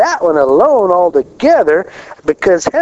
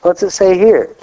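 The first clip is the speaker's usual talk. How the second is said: ordinary talk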